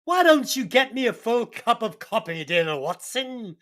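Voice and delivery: hammed-up British gentleman asking for coffee; British accent. Holmes orders the detective for coffee, bad British accent. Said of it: "worst British accent I've ever heard".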